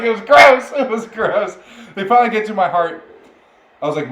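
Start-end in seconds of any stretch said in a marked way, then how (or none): 0.0-1.6 laughing disgust. Retold or imitated.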